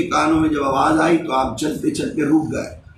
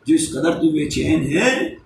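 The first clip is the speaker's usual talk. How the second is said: ordinary talk, nothing marked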